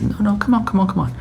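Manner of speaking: high, eager coaxing; urging on. Retold or imitated. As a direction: cheer them on, pitch up high. God urging on, coach-like.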